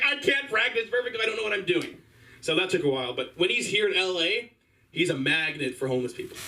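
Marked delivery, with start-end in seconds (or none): 0.0-2.0 high, mock-distressed complaint; comic overwhelmed whine; exasperated protest. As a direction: protest in a raised, laughing wail. Acting out own distress at father's advice.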